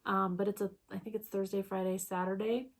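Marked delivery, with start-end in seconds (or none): none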